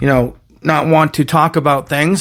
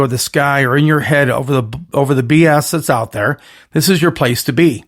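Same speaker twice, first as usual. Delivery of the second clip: ordinary delivery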